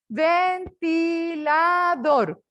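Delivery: "Ventilador" very slow, each of its four syllables held. Shown as a demonstration. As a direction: count out "Ventilador" as ven-ti-la-dor, stretched and separate. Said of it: "Cuatrisílaba". ("Ventilador" muy despacio, separado en cuatro sílabas)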